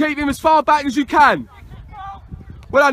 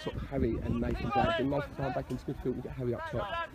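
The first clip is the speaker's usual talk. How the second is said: very low, soft tactical murmur; hushed, much lower voice; quiet aside; 17 dB quieter, faster than usual. Real, not acted. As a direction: confer privately with your assistant.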